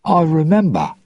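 high, uneasy admission; nervous. A narrator's reading. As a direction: admit it nervously, pitched high. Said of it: "Jack said nervously".

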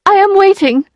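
high, insistent demand; indignant. A narrator's reading. Emma demands an answer of the lawyer, indignant. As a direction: pitch it high and firm, indignant.

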